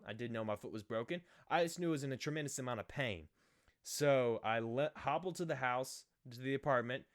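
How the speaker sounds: usual speaking voice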